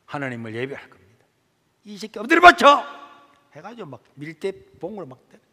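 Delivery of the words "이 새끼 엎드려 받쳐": loud harsh command; barked order. Retold or imitated. Teacher orders the boy down for a beating, loud. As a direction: snap the order at him.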